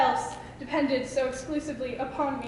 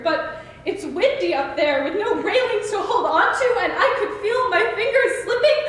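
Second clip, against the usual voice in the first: high, louder, overwrought poetic outburst; rising panic; 6 semitones higher, 4 dB louder than usual. Acted. Poet slipping from the pedestal he put her on, desperate and overwhelmed. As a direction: build to a desperate, pleading peak.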